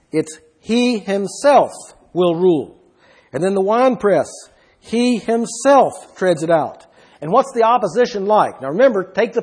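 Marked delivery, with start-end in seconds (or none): 0.1-2.8 slow, with "himself" leaned on hard; stress on himself. Shown as a demonstration. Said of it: "It's emphatic". 4.8-6.9 slow, with "himself" weighted; slow down and lean on "himself" again; stress on himself. Shown as a demonstration. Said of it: "It's emphatic".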